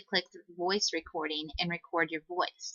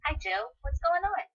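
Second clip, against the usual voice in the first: put-on high-pitched girl character voice; put-on girl's voice, pitched way up; Susie; 11 semitones higher than usual. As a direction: chirp it much higher, as a cartoon girl's line. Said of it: "I'm gonna record Susie's voice".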